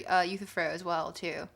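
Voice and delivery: normal talking voice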